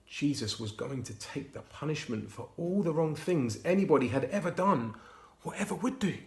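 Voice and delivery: plain reading voice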